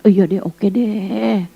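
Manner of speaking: slow, soft, drowsy giving in; soft slow drowsy okay; lazy, sleepy agreement. Retold or imitated. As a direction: mumble it like half asleep.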